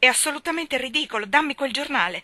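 woman refusing to believe her lover is dead; rebellious outburst. Acted. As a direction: demand the newspaper in denial. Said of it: "mi ribellai".

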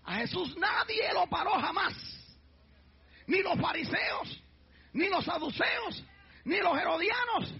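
slow, emphatic build-up; preaching at full force. Real, not acted. Preacher proclaiming no one could stop Jesus, building preaching. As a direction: pile up each name, rising.

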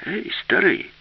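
high-pitched narrated call to someone off. A narrator's reading. Herman hails the old robot, calling out. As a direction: call out to get the old one's attention. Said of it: "гукнув йому Герман".

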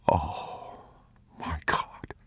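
soft breathy whisper of awe. A narrator's reading. Father whispering at the hidden stores. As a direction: breathe the words, stunned. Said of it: "he whispered".

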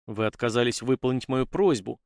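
usual speaking voice

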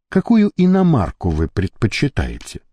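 ordinary delivery

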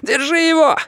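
very high, urgent shout; shouted order. A narrator's reading. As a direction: shout the command to your men. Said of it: "крикнул капитан".

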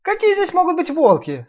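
high scoffing piglet. Acted. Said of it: "сказал Ниф-Ниф".

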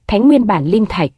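usual speaking voice

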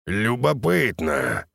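slow, warped monster mutter; a mutating mercenary's distorted murmur. Acted. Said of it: "искажённым голосом пробормотал он".

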